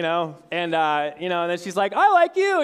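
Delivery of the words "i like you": much higher and louder delighted answer; excited reply. Retold or imitated. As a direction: squeal it back happily. Girlfriend answers his confession.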